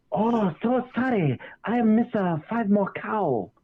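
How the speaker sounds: a light imitation of a Vietnamese accent, slow and apologetic; mock Vietnamese neighbor. [name] imitating the Vietnamese neighbor's apology in a light mock Vietnamese accent. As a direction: mimic the neighbor's apology, slowly. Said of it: "Vietnamese guy, he was like".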